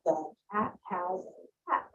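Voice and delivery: slow, each word counted apart; counting words slowly. Shown as a demonstration. Teacher counting words for children, slow and separate. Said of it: "they can count".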